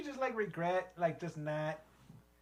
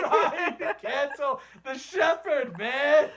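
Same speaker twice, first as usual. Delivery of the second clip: laughing; 5 semitones higher, 9 dB louder, much slower than usual. Real, not acted. Mock outrage while laughing.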